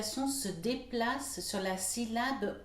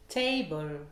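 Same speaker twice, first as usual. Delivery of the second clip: TA stressed in "Table", second syllable falls; first-syllable stress; 3 semitones lower than usual. Shown as a demonstration. Teacher models a common word, stress on TA in "Table". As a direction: hit the start: TA-ble. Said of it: "l'accentuation sur la première syllabe".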